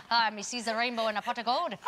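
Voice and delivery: laughing, hammed-up Irish accent; a jokey stage-Irish accent; mock Irish accent. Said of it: "the luck of the Irish".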